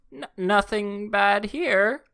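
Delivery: stammered, very high, loud and drawn out; slow, strained adolescent squeal; pubescent boy voice. Retold or imitated. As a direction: squeak it out like a panicked teenage boy. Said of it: "teenage boy voice".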